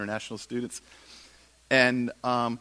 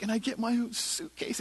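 high, soft voice of an excited student; retold excitement; 8 semitones higher, 4 dB quieter than usual. Student thrilled about her suitcase, excited high voice. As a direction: imitate her delight lightly, pitched up. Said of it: "she's all excited".

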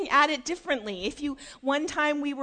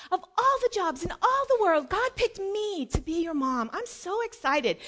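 higher, mildly excited; delighted mother; 6 semitones higher than usual. Acted. Mother telling children God picked her, mildly excited. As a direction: gush a little, pitched up.

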